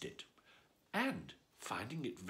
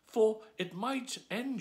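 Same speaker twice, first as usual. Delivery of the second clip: put-on higher, slow girlish worry; Alice's voice; 9 semitones higher, 5 dB louder, slower than usual. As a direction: lift into the little girl's voice.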